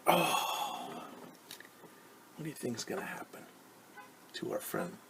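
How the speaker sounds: hushed, breathless whisper to the class; suspense whisper. A narrator's reading. Teacher whispering to children about what happens next, hushed. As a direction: lean in and whisper the question.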